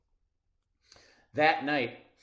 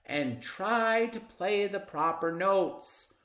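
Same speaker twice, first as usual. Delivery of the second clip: high, slow stern correction; a stern music teacher; 9 semitones higher, 4 dB louder than usual. Acted. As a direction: scold the pupil. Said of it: "snaps Maestro".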